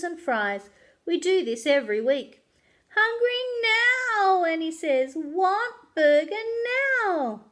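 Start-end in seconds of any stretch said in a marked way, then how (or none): 2.9-4.4 slow, high, teary whine; a small child pleading for food; whining. Acted. Little sister whining for food. 5.2-7.4 put-on slow, teary child's whine; whining. Little sister whining for food.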